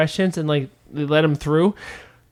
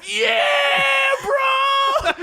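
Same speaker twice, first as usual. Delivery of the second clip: high, shouted "Yeah, bro!"; high excited shout at the crowd; triumphant yell. Retold or imitated. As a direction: yell it out like a proud kid showing off. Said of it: "And I was like".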